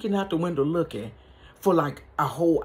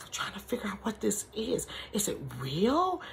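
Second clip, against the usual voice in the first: soft unvoiced whisper, slowing down; whispered wonder; 4 semitones higher, 9 dB quieter than usual. Grandmother wondering at the baby, in a whisper. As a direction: hush it like a puzzled old lady. Granny puzzling over the doll.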